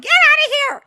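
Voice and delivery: very high, loud imitation shriek; mock scream. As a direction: screech the order in mockery. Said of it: "no straight man is screaming like that".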